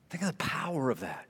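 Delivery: hushed awed whisper; a reverent whisper. Real, not acted. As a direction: breathe it out in awe. Awed aside to congregation.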